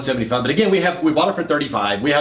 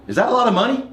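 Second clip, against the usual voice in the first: much higher, knowing question; mock teasing partner; 10 semitones higher, faster than usual. [name] nudging partner to take the offer, mock knowing. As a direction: lead him to the obvious answer.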